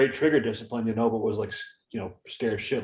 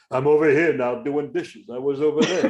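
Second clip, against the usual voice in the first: hammed-up comedian voice; Dice impersonation; 3 semitones higher, 4 dB louder than usual. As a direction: announce chores like a swaggering stand-up. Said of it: "walk around the house all day doing that".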